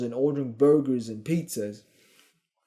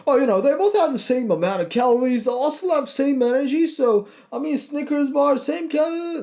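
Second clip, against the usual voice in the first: high, fast whiny mock voice; mocking rationalizer; 9 semitones higher than usual. Mocking a dieter who rationalizes a Snickers, high and rushed. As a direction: mimic the excuse-maker, squeaky and rushed. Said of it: "You can't be like".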